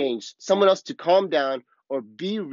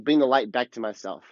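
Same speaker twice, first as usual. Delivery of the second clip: normal talking voice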